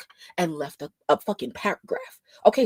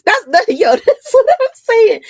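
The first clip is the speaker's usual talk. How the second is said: laughing loudly; 14 semitones higher, 11 dB louder than usual. Real, not acted. Cracks up at her own point.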